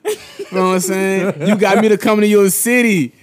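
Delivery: high, loud mock-hurt complaint with laughter; hammed-up mock outrage at a friend over a ticket; mock offended rant. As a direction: play wounded for laughs, voice climbing.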